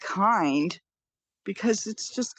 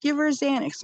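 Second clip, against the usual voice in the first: higher urgent call to give her Xanax; urgent demand; 7 semitones higher than usual. Retold or imitated. Mother urging staff to calm daughter, higher. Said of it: "I'm like".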